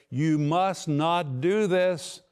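slow and firm, every word pressed home; categorical, emphatic. Acted. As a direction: lay down Jesus' command to the congregation, emphatic and slow. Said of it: "he speaks categorically and emphatically".

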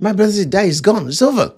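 high-pitched, insistent complaint; acted-out lament. Sister telling Jesus her brother is gone, high-pitched lament.

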